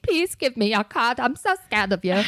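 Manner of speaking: timid pleading, small voice; mock-frightened little plea; meek and scared. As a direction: shrink away as you ask. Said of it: "I probably was like".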